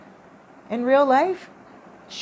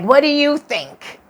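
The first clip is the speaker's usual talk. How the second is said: mocking ghost; 9 semitones higher, 10 dB louder than usual. Ghost answers mockingly in dream.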